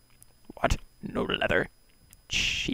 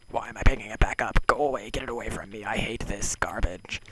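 fast flustered rant; 4 semitones higher, 7 dB louder than usual. Real, not acted. Disgusted at picked-up item.

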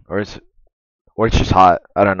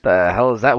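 ordinary talk, nothing marked

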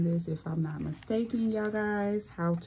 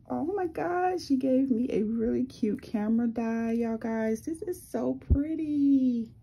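excited squeal; 6 semitones higher than usual. Real, not acted. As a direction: squeal over how cute it is. Gushing over a handmade gift.

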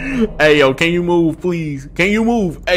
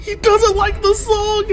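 alarmed. Real, not acted. Repeated, alarmed.

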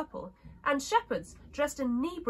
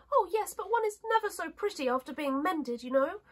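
high-pitched dainty princess voice; storybook princess, pitched up; china princess; 6 semitones higher than usual. Acted. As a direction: explain it in a high little doll voice.

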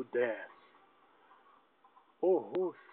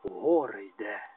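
lower, ominous voice of the mullah; mullah's gloomy pronouncement; 6 semitones lower than usual. Acted. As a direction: intone it like a doom-laden cleric. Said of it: "Молла промовив". (похмуро, нижчим голосом мулли)

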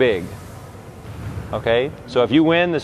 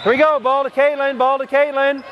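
high call across the court; 11 semitones higher than usual. Real, not acted. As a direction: call the ball to a player. Coach calling during the game.

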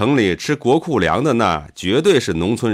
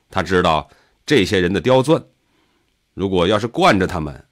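ordinary delivery, nothing marked

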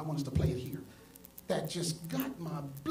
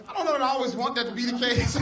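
laughing; 6 semitones higher, 7 dB louder than usual. Real, not acted. Laughing about his daughter's remark.

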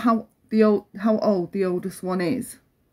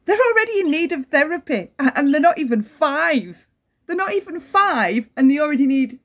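pleading, incredulous that they're not even five; distressed indignation; 6 semitones higher than usual. Real, not acted. Mother talking about foster toddlers needing therapy, distressed and much higher. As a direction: pitch jumps much higher, pressing each point.

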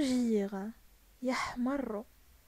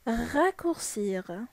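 slow model word; much slower than usual. Shown as a demonstration. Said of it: "Ça veut dire يختصر".

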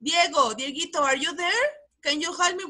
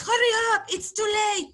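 mock mother's order; 7 semitones higher than usual. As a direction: call it out like an impatient mom. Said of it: "When your mother says".

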